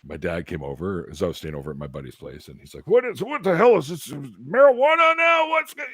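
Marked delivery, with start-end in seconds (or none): 2.9-5.8 high-pitched, worked-up mock dad yell; retold angry yelling. Dad catching his son with supposed marijuana, retold yelling. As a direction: imitate an outraged parent freaking out, voice shooting way up. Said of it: "they're yelling and screaming at me".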